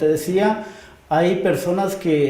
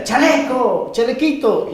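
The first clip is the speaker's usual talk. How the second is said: high, louder mock yell of a nickname; retold shout, pitched up; imitated shout; 7 semitones higher, 4 dB louder, slower than usual. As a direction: call out the nickname like a friend across the street. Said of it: "y me grita".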